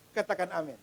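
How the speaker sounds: ordinary talk, nothing marked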